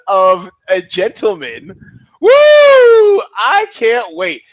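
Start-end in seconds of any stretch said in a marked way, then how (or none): 2.2-4.4 very high, loud drawn-out whoop; excited shout. Real, not acted. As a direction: whoop with real excitement, slowly. Host cheering bold pick.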